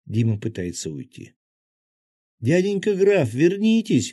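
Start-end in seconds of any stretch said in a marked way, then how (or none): 2.4-4.1 put-on a much higher, louder child's shout; child calling out. A narrator's reading. Little girl shouts after the count to come back. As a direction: call him back across the crowd. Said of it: "крикнула".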